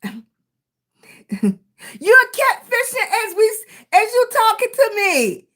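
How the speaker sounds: laughing at a viewer fishing while chatting. Real, not acted. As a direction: laugh through it in disbelief. Laughing at viewer catfishing live.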